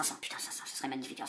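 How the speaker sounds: whispered under the breath; hushed, almost voiceless wish; whispered hope. Real, not acted. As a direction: breathe it out, hoping hard. Hoping for the 500.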